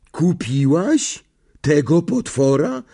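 slow, high incredulous question; aghast disbelief. A narrator's reading. Boy asking friend about her monster cat, aghast disbelief. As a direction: ask it as if unable to believe it. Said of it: "szczęka mu opadła".

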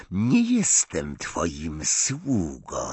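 ordinary delivery

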